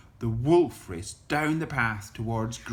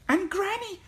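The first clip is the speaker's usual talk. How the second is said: put-on high little-girl voice; careful, high-pitched address. A narrator's reading. Little Red questioning the disguised wolf, careful high voice. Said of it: "Little Red said carefully".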